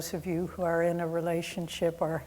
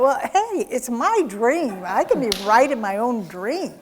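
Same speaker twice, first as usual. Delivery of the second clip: huge indignant reaction; 3 semitones higher, 8 dB louder than usual. Retold or imitated. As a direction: protest loudly, playing up your own indignation. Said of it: "I had this huge reaction".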